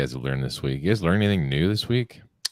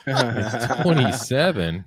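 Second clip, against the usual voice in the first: real laugh through a slow, higher question; laughing disbelief; 6 semitones higher, much slower than usual. Real, not acted. Cohost cracking up at a friend's twenty-seven cameras, laughing. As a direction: laugh as you repeat the number back.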